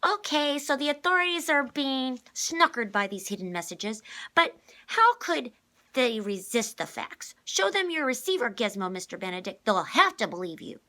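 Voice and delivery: put-on fed-up girl challenging the adults, loud; exasperated. A narrator's reading. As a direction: push the point hard, fed up. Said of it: "Constance seemed exasperated".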